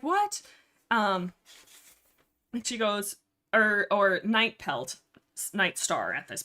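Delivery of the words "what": high, rising disbelieving what; shocked question. Retold or imitated. Retelling Blue Star's reaction to accusation, mock shock. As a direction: imitate a taken-aback reply, pitched way up.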